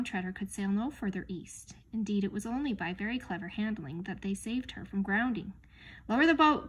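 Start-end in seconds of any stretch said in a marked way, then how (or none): 6.1-6.7 raised, louder command; cried out. A narrator's reading. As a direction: call out an order higher and louder. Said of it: "cried Caspian".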